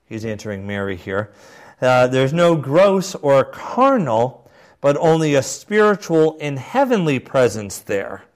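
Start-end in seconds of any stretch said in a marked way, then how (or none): 2.1-8.2 higher, louder, slow declamation; measured, emphatic oratory; formal quotation reading. Acted. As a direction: read it like a solemn proclamation. Resumes bishop's quotation.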